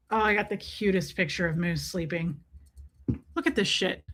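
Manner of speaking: ordinary delivery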